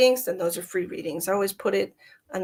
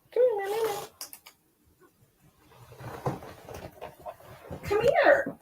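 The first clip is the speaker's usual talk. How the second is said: high sing-song kitty call; calling the cat; 13 semitones higher, 4 dB quieter than usual. Real, not acted. Calling her cat to come.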